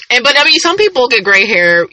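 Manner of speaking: ordinary talk, nothing marked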